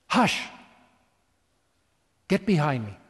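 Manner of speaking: ordinary talk, nothing marked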